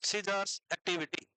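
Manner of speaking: very slow, word by word; slow dictation. Shown as a demonstration. Teacher dictating notes to students, very slow. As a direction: dictate so they can write along. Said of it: "अभी मैं रुक रुक के बोलूंगा".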